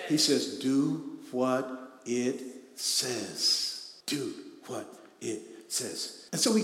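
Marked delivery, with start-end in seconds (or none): 0.6-3.9 very slow, deliberate read; drawn-out scripture command; slow emphatic. Acted. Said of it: "repeated quote, slow". 4.1-6.3 much softer, falling repeat; hushed echo of the command. Acted. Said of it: "repeated quote, soft".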